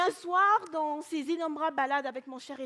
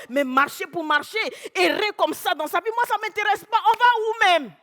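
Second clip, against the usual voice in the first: building to a loud protest; exasperated protest; 5 semitones higher, 10 dB louder than usual. Acted. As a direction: grow louder and more fed up. Wife's mounting protest in quarrel.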